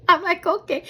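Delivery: high okay after a laugh; amused. Retold or imitated. Amused by boyfriend's cactus joke, high amused okay. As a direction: pitch it up, amused.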